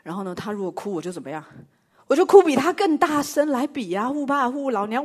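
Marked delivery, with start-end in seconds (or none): 2.1-5.0 mock wailing.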